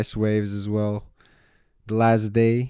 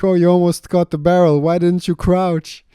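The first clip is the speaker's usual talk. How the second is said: high instructor voice; 8 semitones higher, 8 dB louder than usual. Retold or imitated. Instructor teasing after wipeout.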